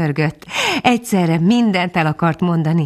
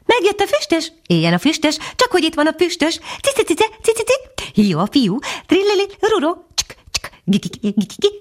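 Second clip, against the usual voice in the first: high-pitched chirping, trilling chorus of birds; a jumble of twittering, whistling little bird voices; chattering birdsong; 12 semitones higher than usual. A narrator's reading. Birds greeting the returning dog, high excited chatter. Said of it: "madárhangok köszöntöttek rá. Csevegő, fuvolázó, csattogó, fütyülő hangok".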